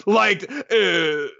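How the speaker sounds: high, faltering character voice; Ren. Acted. Ren's confidence fades mid-confession, high and faltering. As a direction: start high, then stall on a long uh. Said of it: "the power fades away immediately".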